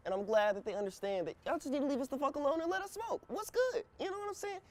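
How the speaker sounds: rising high and excited; hyped. Real, not acted. Rant about being left alone.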